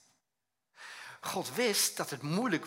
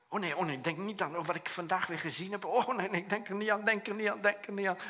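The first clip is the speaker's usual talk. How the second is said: anxious inner voice; 6 semitones higher, 4 dB louder than usual. Retold or imitated. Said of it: "Hoef ik niet meer te denken van".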